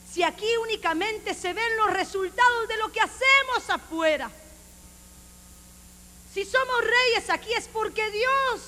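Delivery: loud, rising preacher's cry; fiery preaching. Acted. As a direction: preach it out at full force. Preacher rising to full force.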